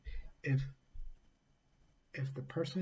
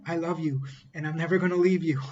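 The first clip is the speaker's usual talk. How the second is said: imagined declaration; 3 semitones higher, 8 dB louder, faster than usual. Retold or imitated. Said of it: "what he heard in his mind was".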